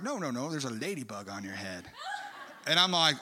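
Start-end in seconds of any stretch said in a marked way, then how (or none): 0.0-1.9 Bob Jones. Retold or imitated. Preacher friend corrects him about the ladybug, Arkansas accent. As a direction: imitate an Arkansas accent, soft and low. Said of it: "little Bob Jones impersonation".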